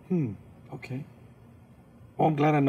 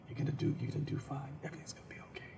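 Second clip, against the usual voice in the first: whispered self-reassurance; 12 dB quieter, much faster than usual. Retold or imitated.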